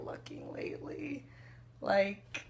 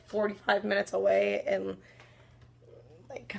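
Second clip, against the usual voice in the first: normal talking voice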